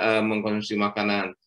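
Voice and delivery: ordinary talk, nothing marked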